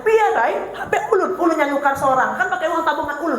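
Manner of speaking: high, laughing mock protest of a child; defiant child. Child talking back to mother. (anak membantah ibunya, protes pura-pura bernada tinggi)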